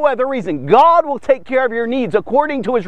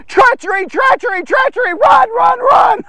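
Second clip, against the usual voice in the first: high, hammed-up cry of alarm, building louder; panicked calling out; 11 semitones higher, 4 dB louder than usual. Fleeing king warns his ally of treachery, high panicked calling out. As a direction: call out in mock panic, pitch climbing to a shout. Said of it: "calling out to Ahai".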